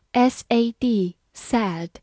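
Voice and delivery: S A D, sad, letters named slowly then the word; slow spelling for learners; spelled out letter by letter. Shown as a demonstration. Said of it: "字母A发大口的A".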